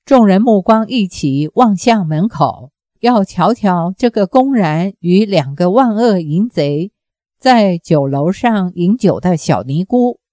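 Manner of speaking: ordinary delivery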